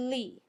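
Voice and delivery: Lee alone as one beat, L held; isolated syllable. Shown as a demonstration. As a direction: say Lee on its own, lllee. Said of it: "tap out the second one".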